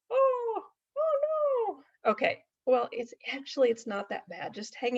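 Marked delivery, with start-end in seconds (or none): 0.1-2.1 much higher puppet oh no; dismayed puppet. Acted. Puppet reacting to the cat lying in the freezer, much higher dismayed voice. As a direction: moan it as a stricken puppet.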